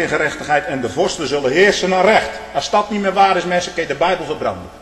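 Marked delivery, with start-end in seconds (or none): none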